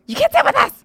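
put-on high, loud mean-girl shout; mock Mean Girls shout. Quoting a mean girl, shrill shout. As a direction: bark it like a queen bee.

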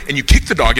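usual speaking voice

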